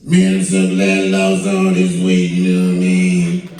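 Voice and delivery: mock Lavelle relieved; Lavelle Crawford. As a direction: boom it out like a heavy comic. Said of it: "how the conversation went down".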